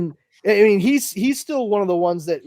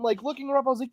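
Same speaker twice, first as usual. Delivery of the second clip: ordinary talk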